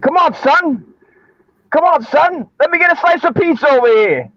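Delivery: high, hammed-up New York street voice; Brooklyn accent. Shown as a demonstration. Host shows guest how to do a New York, mock Brooklyn accent. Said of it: "like a Brooklyn?".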